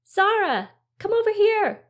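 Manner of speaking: high, loud call across the garden. A narrator's reading. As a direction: call your sister over from afar. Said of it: "Theo called".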